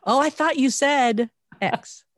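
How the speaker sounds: high, slow, exaggerated quote; mock realization. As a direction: act out discovering you misheard, drawn out. Acts out mishearing in an argument.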